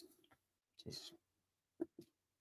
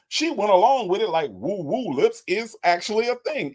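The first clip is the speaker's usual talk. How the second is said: normal talking voice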